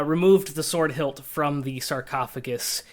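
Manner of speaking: ordinary talk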